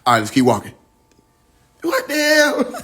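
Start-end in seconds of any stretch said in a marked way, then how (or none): 1.8-2.8 high laughing what the hell; real amused disbelief, laughing. Real, not acted. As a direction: laugh it out, amazed. Laughing at the prophecy coming true.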